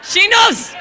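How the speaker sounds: high, loud shout of recognition; excited shout. Real, not acted. Shouting to a woman in the audience, high and loud. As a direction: call out to her, high and loud.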